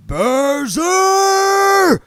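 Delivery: bellowed name, very high and loud; shout. A narrator's reading. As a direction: roar it up the stairs. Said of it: "he would stand at the bottom of the stairs and shout".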